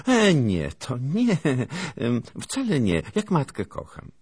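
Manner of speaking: put-on high, coy girl's voice; feigned bashfulness, smiling. A narrator's reading. Girl twisting her braids answers him, coyly flustered. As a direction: protest with a smile, loud and high. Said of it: "niby zmieszana odpowiedziała z uśmiechem".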